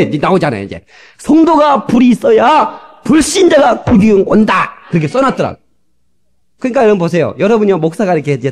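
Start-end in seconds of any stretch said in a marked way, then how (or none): 1.2-4.7 slow, high shout of the motto; preacher shouting the written motto to the congregation; shouted proclamation. Shown as a demonstration. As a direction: call it out like a rallying cry.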